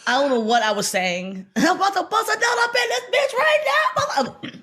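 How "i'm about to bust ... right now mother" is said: went off. Retold or imitated. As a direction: let loose like something took over. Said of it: "what demon took over me".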